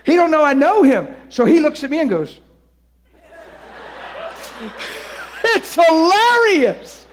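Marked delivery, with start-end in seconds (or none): 3.3-5.4 breathy silent laugh. Retold or imitated. Friend's silent laugh imitated. 5.4-7.1 high, slow, loud, laughing; telling how funny it was, laughing high and slow. Retold or imitated. Quoted amid laughter.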